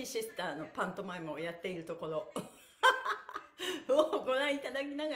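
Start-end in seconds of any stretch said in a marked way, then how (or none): 2.8-3.8 loud, high laugh; burst of laughter. Real, not acted. Instructor laughing while praising the teacher's show, loud. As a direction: let a laugh break out.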